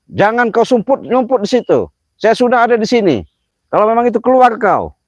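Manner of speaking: calling out the unseen coward; furious shout. Real, not acted. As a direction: bellow a challenge into the dark. Shouting a challenge at spirits.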